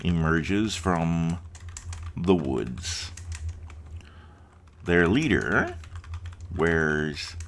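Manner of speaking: slow, dragged-out storyteller reading; story narration. Acted. Gamer reading his opening to viewers, slow storytelling. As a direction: read the scene slowly, like a fireside tale.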